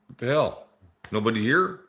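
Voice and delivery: ordinary talk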